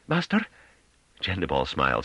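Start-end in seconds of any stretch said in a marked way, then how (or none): 0.1-0.4 a worried high call of Master?; anxious question, pitched high. A narrator's reading. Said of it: "Novi said anxiously".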